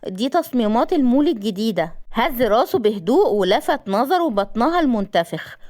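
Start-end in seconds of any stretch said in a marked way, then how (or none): none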